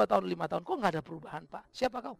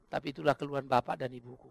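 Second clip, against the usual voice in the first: usual speaking voice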